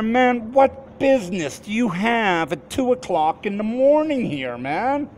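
high-pitched, incredulous challenge to the driver; retold indignant question.

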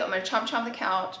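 normal talking voice

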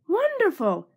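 high, loud delighted Wonderful; delighted exclaim. Acted. Detective thrilled at a clue, loud and delighted. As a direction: burst out with glee at the find.

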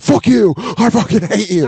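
mock fury. After falling while a friend cackles, high mock rage. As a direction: yell it like a humiliated kid.

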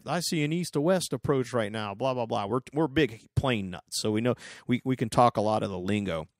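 normal talking voice

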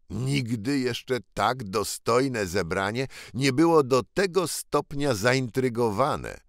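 usual speaking voice

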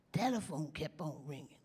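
ordinary talk, nothing marked

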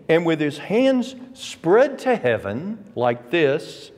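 normal talking voice

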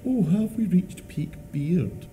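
high, drawn-out mocking headline voice; mock journalist headline. Bearded man quoting journalists' headlines, high mocking voice. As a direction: read it as a silly, slow, high-pitched headline. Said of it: "write articles with headlines like".

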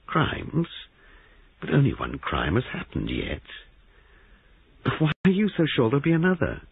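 sudden high startled questions; startled disbelief. Acted. Giles, stunned by the sergeant, asking in high disbelief. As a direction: caught off guard, pitch leaping.

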